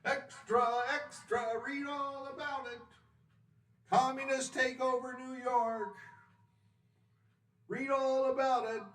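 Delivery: high newsboy hawking papers; rapid old-time reporter. Acted. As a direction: cry out the headlines on the corner. Newsboy shouting headlines.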